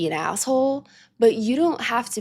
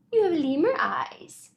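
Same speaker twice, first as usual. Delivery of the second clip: high whisper, lips close to the cat; 7 semitones higher than usual. Real, not acted. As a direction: hush it like a secret for the pet. Cooing to her cat.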